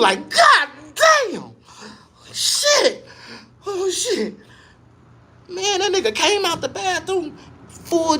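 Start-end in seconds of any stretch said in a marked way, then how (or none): 0.3-4.3 put-on high strained old man. OG groaning in bathroom.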